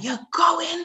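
imitating a cousin's high insistence; mock shout. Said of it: "She started shouting".

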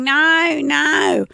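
high, drawn-out mock denial; retold protesting denial. Host acting out Michael denying infidelity, mock protest. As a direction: imitate a man protesting his innocence. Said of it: "He's saying".

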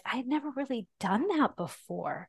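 normal talking voice

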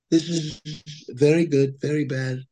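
light Trump voice, short and pompous; a hint of Trump's clipped slogans; Trump impression. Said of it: "Trump and this".

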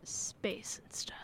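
slow whispered echo; whispering. Pretend. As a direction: whisper it back slowly. Echoing the answer.

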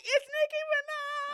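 high-pitched, loud fangirl scream; screamed celebrity announcement, mimicked at full volume; imitated excited scream. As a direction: shriek it out like a starstruck interviewer. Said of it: "she, like, screamed".

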